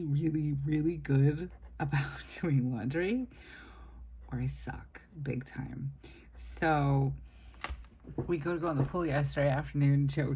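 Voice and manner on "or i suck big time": slow, voiceless confession; whispered aside. Real, not acted. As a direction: whisper it like a guilty secret. Joking self-deprecating aside.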